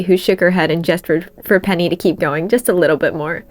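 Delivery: ordinary delivery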